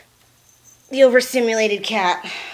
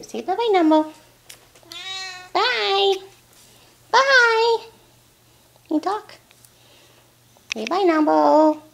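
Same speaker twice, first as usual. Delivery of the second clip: much higher and louder, calling bye to the kitty; pet talk goodbye; 9 semitones higher, 7 dB louder, slower than usual. Real, not acted. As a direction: coax the cat into saying bye. Coaxing cat to wave goodbye.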